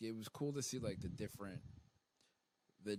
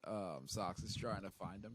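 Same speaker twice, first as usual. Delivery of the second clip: ordinary talk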